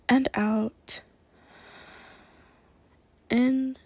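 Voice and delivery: breathy hypnotic guidance; whispered hypnosis. Acted. Hypnotist starts trance induction.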